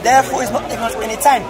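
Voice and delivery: ordinary talk, nothing marked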